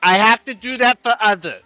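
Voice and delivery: put-on high-pitched childish protest; upset little dragon. Dragon protesting in acted quarrel.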